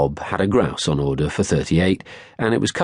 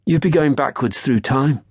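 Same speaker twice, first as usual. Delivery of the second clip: sneering jibe; 8 semitones higher than usual. A narrator's reading. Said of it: "he said".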